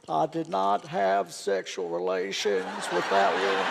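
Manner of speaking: slow, drawled scandal denial, lightly voiced; hint of a famous president's slow denial; politician impression. To the judges, a slow mock presidential denial. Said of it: "Who's this?".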